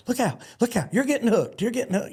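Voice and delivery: high, urgent calling out; mock warning yell. Crowd yelling at barrelman to look out, mock warning yell. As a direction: shout a warning to a rider.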